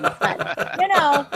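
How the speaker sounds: laughing through the words. Real, not acted. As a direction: break into a real laugh. Frozen cabin plumbing joke.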